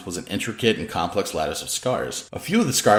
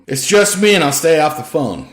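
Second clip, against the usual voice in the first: louder voice carried between rooms; calling out; 8 semitones higher, 9 dB louder than usual. A narrator's reading. Lori calls back to Danny from her room, raised voice. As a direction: project it through the wall. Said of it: "Lori called out from her room".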